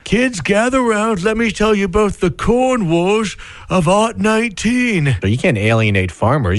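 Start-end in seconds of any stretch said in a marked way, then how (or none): 0.0-5.1 put-on high, slow old-timer voice; mock old storyteller. Co-host plays an old man telling kids of the corn wars. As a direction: gather the kids like a grandpa.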